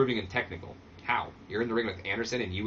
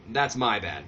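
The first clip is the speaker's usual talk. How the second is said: normal talking voice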